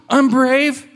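high, eager kitten voice; high-pitched, hammed-up kid excitement; excited kitten. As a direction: burst out with a dawning idea. Kitten realizes he fits, story.

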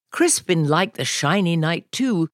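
ordinary delivery, nothing marked

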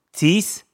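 Teeth? tried out high and rising, th slurred to s; checking a lisp. Real, not acted. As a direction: test the word Teeth? with a questioning high rise. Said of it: "some lisp stuff going on".

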